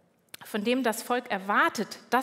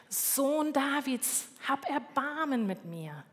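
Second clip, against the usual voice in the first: drawn-out, higher call without extra volume; slow pleading call, held back in volume; retold calling out; 4 semitones higher, slower than usual. Blind beggar calls out to Jesus on the roadside, restrained drawn-out call. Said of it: "Und der ruft".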